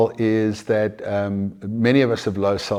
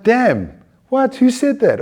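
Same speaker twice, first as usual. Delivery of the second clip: high-pitched, incredulous challenge; acted indignant surprise; 12 semitones higher, 3 dB louder, slower than usual. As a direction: suddenly call out the inner voice.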